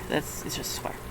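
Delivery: soft, whispered disappointment; deflated whispered aside; muttered whisper. Real, not acted. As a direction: whisper it under your breath.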